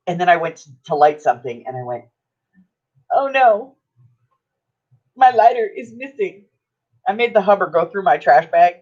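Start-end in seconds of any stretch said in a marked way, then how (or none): 3.1-6.5 high-pitched retold dismay; mock alarm. Storyteller reliving finding the lighter gone, mock alarm. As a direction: replay the little panic in a raised voice. Retells panic at lost lighter.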